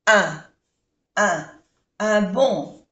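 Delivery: "un" held as a nasal vowel, slowly. Shown as a demonstration. Teacher drilling the nasal vowel in "un bon". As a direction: hum the nasal "un" before "bon". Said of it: "Es una vocal nasal".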